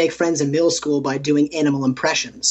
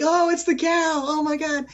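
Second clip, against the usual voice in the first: high-pitched mock amazement; excited onlookers; 13 semitones higher than usual. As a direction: imitate impressed kids, much higher than usual. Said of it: "people would be like".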